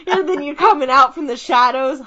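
ordinary talk

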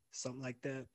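normal talking voice

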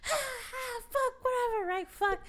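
high, whiny frustrated curse; mock wail at missing the shot; frustrated high cry. Woman missing her shot, high whiny frustrated cry.

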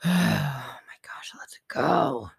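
slow, breath-heavy whisper sinking low; exasperated inner voice. Retold or imitated. Mother in her mind waiting on daughter, exasperated inner whisper. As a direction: whisper it on a long exhale, impatient. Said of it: "in my mind, take a breath".

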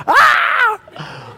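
loud, very high comic shriek; mock dad's scream of panic; hammed-up horrified scream. Dad's horrified reaction, retold.

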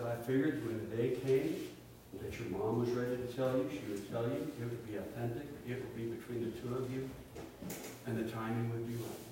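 soft, hushed dad. Retold or imitated. Dad's quiet talk in the dark.

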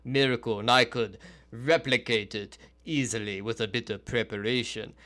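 measured, boastful fake foreign accent; Vinovich's put-on accent. Host mimics the magician dismissing miracles, in a put-on accent. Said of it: "breaks his accent".

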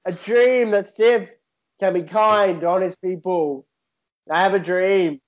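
put-on Scouse accent, speech cadence; MLK-style dream speech in Scouse. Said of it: "repeat that speech but do a Scouse accent".

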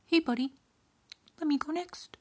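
high-pitched goat character voice; middle goat. A narrator's reading. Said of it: "said the middle goat".

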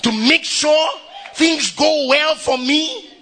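a joyful preacher's ringing call; shouted exhortation. Acted. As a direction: rouse them and hammer it home. Excited exhortation.

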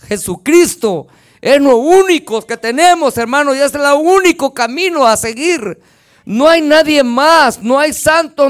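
fiery pulpit preaching; full-force preaching. Acted. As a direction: proclaim it to the congregation. Preacher denounces idols and the devil.

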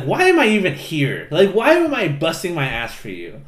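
hammed-up fed-up outburst; indignant mock complaint. Rain protests at being berated.

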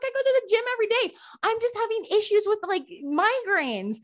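high, pleading imitation of teen self; mock-upset insistence; retold tearful protest. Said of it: "bawling her eyes out".

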